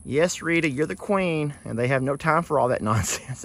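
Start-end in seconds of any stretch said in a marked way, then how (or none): 0.0-1.5 high, humouring reply; voicing the donkeys. Owner voicing donkeys humouring Rita, high and dismissive. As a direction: indulge her like weary friends.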